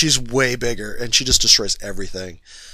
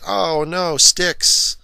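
higher, hammed-up cry of alarm; mock fright; 6 semitones higher, 3 dB louder, much slower than usual. Storyteller mocking a monster afraid of sticks, mock fright. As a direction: play a badly faked scare.

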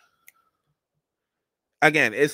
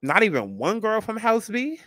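incredulous; 7 semitones higher than usual. Real, not acted. Outraged at the show's casting.

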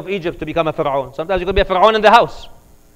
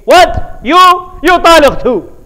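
loud, high incredulous yell; angry outburst; 13 semitones higher, 12 dB louder, slower than usual. Retold or imitated. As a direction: whip round and shout at her too. Husband turns on fourth wife.

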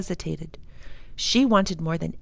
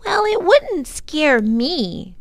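put-on high, slow, louder little girl boast; a small sister insisting she is not scared; declaring; 9 semitones higher, 6 dB louder, slower than usual. A narrator's reading. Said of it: "she declared".

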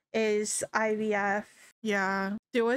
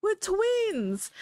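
excited announcement; 8 semitones higher, slower than usual. Retold or imitated. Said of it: "Brandy's like".

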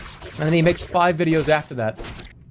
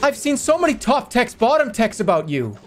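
YouTuber voice; 7 semitones higher than usual. Acted. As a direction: gush like an excited YouTuber. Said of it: "The grandson has the YouTuber voice".

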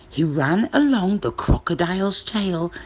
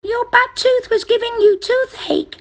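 very high, tiny mouse character; little mouse voice; 4 dB louder than usual. Acted.

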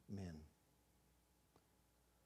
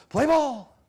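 loud, high shout; hollered call; 19 dB louder than usual. Acted. Players restarting the game after the search, hollering. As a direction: holler it across the gym.

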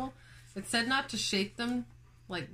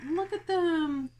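cooing at bugs; 9 semitones higher, 4 dB louder, slower than usual. Real, not acted. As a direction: adore them out loud. Cooing at mites on finger.

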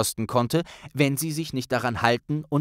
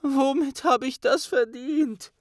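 muffled into hands. Acted. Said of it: "nuschelte Hermine in ihre Handflächen".

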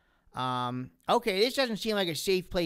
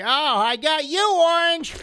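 drawn-out, high, loud gloat; excited outburst; 8 semitones higher, 8 dB louder, slower than usual. Real, not acted. Player crowing over catching Orange, excited. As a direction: crow it loudly, rising to the last word. Gleeful win in the game.

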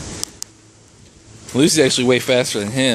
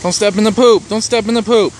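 raised, higher voice warning the dogs; warning call; 10 semitones higher, 6 dB louder than usual. Real, not acted. Owner warning her playing dogs about the poop, loud call. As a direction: call the warning out across the yard.

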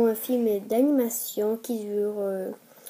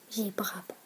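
unvoiced whisper, much softer; whispered aside; 4 semitones lower, 11 dB quieter than usual. Real, not acted. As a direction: mutter it under your breath as a joke. Self-mocking aside after silly joke. (chuchoté à part)

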